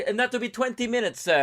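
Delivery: high-pitched Italian vendor; mock Italian accent. Italian vendor telling the customer the wait, mock Italian accent. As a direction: lilt it up like a put-on Italian. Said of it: "he'd probably be Italian".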